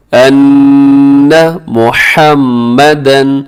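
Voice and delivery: slow, drawn-out recitation; measured teaching chant; tajweed recitation. Shown as a demonstration. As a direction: recite slowly with long held vowels. Recites for learners.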